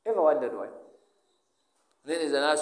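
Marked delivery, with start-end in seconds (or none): none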